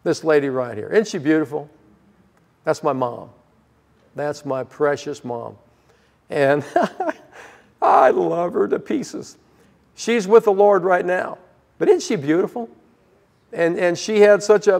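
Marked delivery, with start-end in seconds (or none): 0.9-12.7 tender, fond. Real, not acted. Showing photo of late mother.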